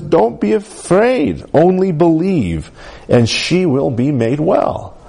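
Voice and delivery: Jesus comforting a grieving father, slow and tender; tender promise. Acted. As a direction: lift it gently like a promise. Preacher voicing Jesus's promise.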